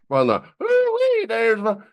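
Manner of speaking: high-pitched hammed-up Southern hollering; cartoon Southern falsetto. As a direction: mimic a Hee Haw Southern greeting, way up high. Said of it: "stereotypical Southern kinda accent thing".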